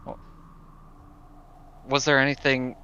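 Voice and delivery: ordinary talk, nothing marked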